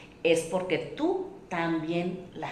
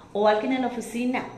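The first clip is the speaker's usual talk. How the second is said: usual speaking voice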